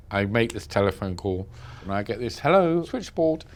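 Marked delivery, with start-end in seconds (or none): 2.4-3.4 imitating a London operator's voice, higher and slow; a posh-ish London operator answering, slow and high; London switchboard voice. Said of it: "that beautiful estery voice, that London voice".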